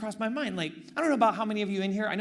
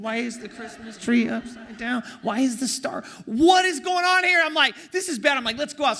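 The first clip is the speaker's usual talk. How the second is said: mock panic; 5 semitones higher, 4 dB louder than usual. Panicking over upside-down tree.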